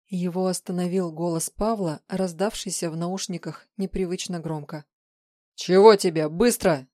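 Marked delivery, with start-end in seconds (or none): 5.5-6.9 loud, curt demand; impatient snap. A narrator's reading. Man interrupted mid-chase by his colleague, loud and impatient. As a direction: demand it at once, with force.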